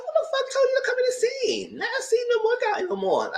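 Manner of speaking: very high laughing protest; mock outraged squeal. Fan scolding JoJo for walking in, high laughing squeal. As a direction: whine like he ruined it.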